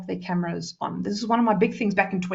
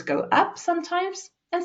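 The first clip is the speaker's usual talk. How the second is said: voice going up; 9 semitones higher than usual. Shown as a demonstration. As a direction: pitch leaps high. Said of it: "with intonation to make your voice".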